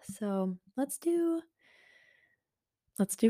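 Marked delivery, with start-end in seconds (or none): none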